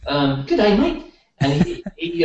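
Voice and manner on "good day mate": high, hammed-up Australian greeting; playful fake Australian accent, pitched high; mock Australian. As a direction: toss off a jokey Australian good day. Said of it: "bad Australian accent".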